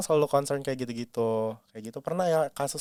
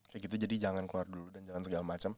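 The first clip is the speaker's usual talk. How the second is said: hushed warning; 5 semitones lower, 9 dB quieter than usual. Retold or imitated. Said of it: "aku bilang kayak".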